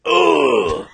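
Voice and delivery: loud, exaggerated "ugh"; mock disgusted groan. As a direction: groan as if the drink tastes awful. Retold disgust after a sip.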